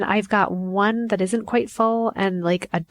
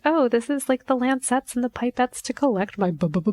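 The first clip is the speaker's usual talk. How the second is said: high, put-on brightness into a stutter; acted anxious, higher-pitched explanation breaking into stammering; nervous stammer; 6 semitones higher than usual. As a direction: answer a child high and nervously, tripping on the B. Falsely cheery answer, then stammers.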